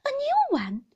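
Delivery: very high, loud startled question; alarmed squeal of a question; shocked echo. A narrator's reading. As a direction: repeat it in a child's disbelief. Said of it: "I repeated".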